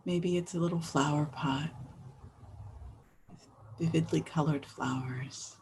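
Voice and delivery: gentle guiding voice with long pauses; guided meditation. Real, not acted. Host inviting everyone to think about the world around them, guided meditation. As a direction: ease listeners in, leaving space after each phrase.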